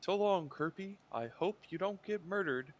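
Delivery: lightly acted parting call; in-character farewell. Player voices character's farewell.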